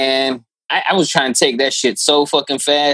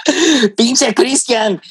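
high, slow scolding in Spanish; laughing, put-on motherly cursing; mock exasperated mother; 9 semitones higher, much slower than usual. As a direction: play a fed-up mom, drawn out and high. Listener voices the annoyed mother.

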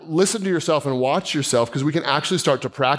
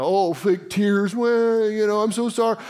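higher, mocking sarcasm, slow on fake tears; cynical sneer; 6 semitones higher than usual. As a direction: sneer at a public apology. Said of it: "I'm so cynical".

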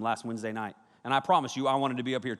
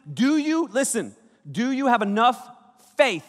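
fiery preaching; 2 semitones higher, 6 dB louder than usual. Acted. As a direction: preach hard at the congregation. Preaching at full force.

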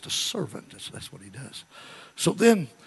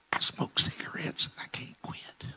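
whisper; 2 semitones higher, 6 dB quieter than usual. Retold or imitated. Woman confessing to the preacher, whispered. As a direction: whisper it like an embarrassed secret. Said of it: "she s-, whispers to him".

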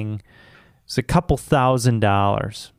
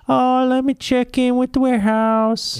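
high-pitched mock customer-service voice; mock store clerk; 12 semitones higher than usual. Store rep stalling an annoyed customer, mocking high voice. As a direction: imitate a useless clerk way up high.

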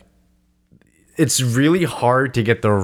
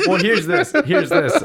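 laughing. Real, not acted. Laughing, pitch jumps up.

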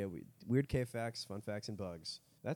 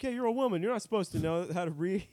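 mock heckler; 7 semitones higher, 5 dB louder than usual. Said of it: "I'm like".

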